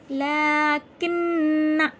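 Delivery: "लाकिन्ना" chanted loud, long laa and n, short end; short final alif. Shown as a demonstration. Teacher showing correct reading, slow "लाकिन्ना" with short ending. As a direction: hold the nn of "लाकिन्ना", clip the final na. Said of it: "किन्ना नहीं पढ़ेंगे".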